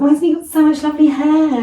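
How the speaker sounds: high, loud admiring coo; exaggerated sing-song praise, voice pitched right up; mock baby-cooing. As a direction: send up a gushing baby admirer. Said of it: "they say things like".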